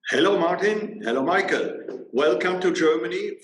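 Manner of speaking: usual speaking voice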